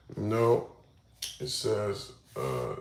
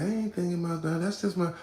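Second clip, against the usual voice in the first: imitating girlfriend; 7 semitones higher than usual. Imitating her, higher voice.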